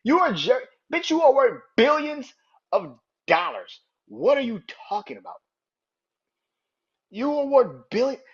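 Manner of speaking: loud, high-pitched acted tirade at the TV; outraged retort. Fan replaying his shouting at the owner on TV, outraged. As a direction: yell back at the screen in exasperated disbelief. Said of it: "I was like".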